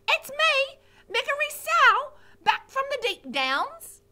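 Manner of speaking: put-on high, loud, strained girl's shout; a simple scullery girl yelling her return; shouting Mig. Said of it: "she shouted".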